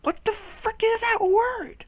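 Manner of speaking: very high, drawn-out disbelief; high-pitched comic frustration; exasperated squeal. Real, not acted. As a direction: squeak it out slowly, baffled by the word. Exasperated at failing to pronounce word.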